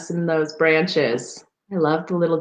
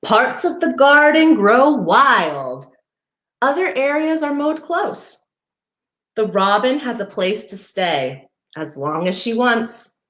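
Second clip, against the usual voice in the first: gentle storybook read-aloud; reading to children; 3 semitones higher than usual. Acted. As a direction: read it like a picture book for little ones. Reading picture book to children.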